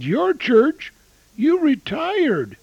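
surprised. Retold or imitated. Friend retired yet asks favor.

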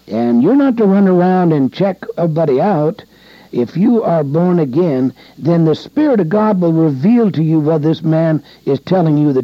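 full-voiced sermon exhortation; fervent preaching. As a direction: warn them with rising fervor.

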